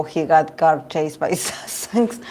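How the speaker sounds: usual speaking voice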